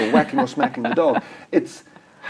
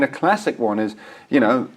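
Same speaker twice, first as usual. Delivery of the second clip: ordinary talk, nothing marked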